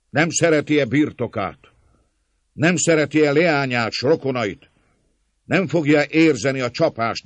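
loud, high, hammering questions; declaimed threat to a crowd, pitched high; vengeful peasant leader. A narrator's reading. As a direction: argue relentlessly for revenge.

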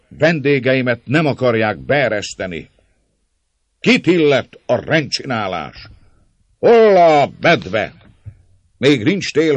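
high, loud, blustering harangue; shouted demand. A narrator's reading. András playing to the mob at the gate, shouting. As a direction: bellow for the door to open.

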